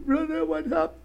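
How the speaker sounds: high, hushed, pitying cry; lightly voiced weepy lady; wailing church lady. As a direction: mimic a fretting woman at a sickbed. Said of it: "She's touching and said".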